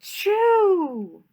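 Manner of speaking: high, loud demo sneeze "Achoo!". Shown as a demonstration. Showing the children how to sneeze, loud sneeze. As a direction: sneeze it out big for the kids. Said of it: "Show me how you sneeze".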